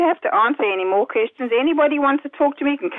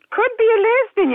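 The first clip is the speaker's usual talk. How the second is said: outraged; 8 semitones higher than usual. Real, not acted. Outraged echo of his note.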